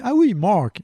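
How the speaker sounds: a French dad attempting "Mark"; "Mark" said in a clumsy English accent; awkward English. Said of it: "ça sonne bizarre".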